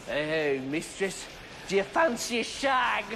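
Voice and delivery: mock English pickup line; softly sleazy fake English accent; stupid put-on English accent. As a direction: parody a fake-English seducer. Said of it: "put on some kind of stupid fucking accent".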